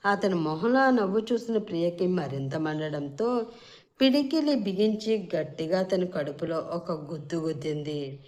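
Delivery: plain reading voice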